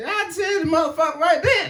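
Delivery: high, emphatic shouted refusal; high comic shout. Real, not acted. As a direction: yell it straight out.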